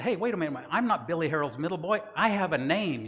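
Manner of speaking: high, loud mock indignation; indignant protest. Acts out his imagined indignant protest.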